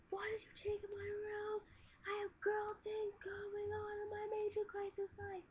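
high-pitched whiny sister voice; imitating a frantic sister, high and whiny; mimicking angry sister. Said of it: "She starts screaming at me".

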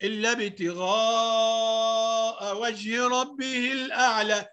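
slow chant with a long madd; Quran recitation. Acted. Teacher modelling the verse, slow recitation. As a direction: recite slowly, stretching the long vowels.

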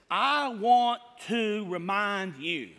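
"I want to remind you" drawn out word by word; slow, weighty emphasis; emphatic statement. Shown as a demonstration. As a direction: lean slowly and firmly on each word of "I want to remind you". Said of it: "look at this emphatic statement".

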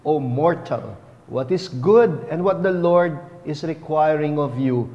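usual speaking voice

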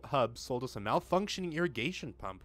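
ordinary talk, nothing marked

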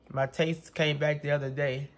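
usual speaking voice

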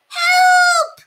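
very high, loud scream for help; panicked squeal; terrified shout. A narrator's reading. Little mouse fleeing the cloud cat, screaming. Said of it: "shouted the little mouse".

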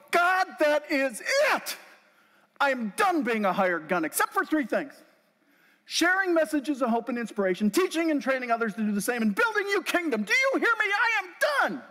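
yelling at God. Retold or imitated. Speaker yelling at God after mic shuts off, furious. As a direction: rage at the sky, voice cracking high. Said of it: "I'm yelling at God".

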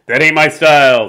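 cocky Casey. Acted. Casey brushing off the pitch, lightly swaggering. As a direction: toss it off like a proud slugger. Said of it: "said Casey".

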